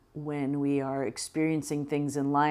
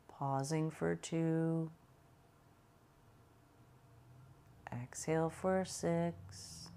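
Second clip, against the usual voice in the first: guided meditation; 5 dB quieter than usual. Acted. Guides a slow breathing meditation.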